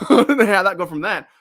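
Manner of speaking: talking through a laugh; chuckling while rambling. Real, not acted. Laughs at own tangent.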